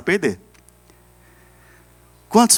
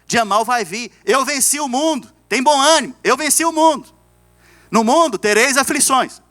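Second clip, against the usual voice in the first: high, ringing proclamation of scripture; triumphant preaching; 9 semitones higher, 4 dB louder than usual. Real, not acted. Pastor quoting Jesus on overcoming the world, triumphant preaching. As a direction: proclaim the victory to the congregation.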